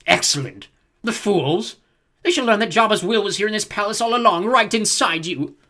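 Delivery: loud, high, triumphant villain crowing; gloating villain voice. Acted. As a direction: crow it with evil delight. Zorba gloats over hidden will.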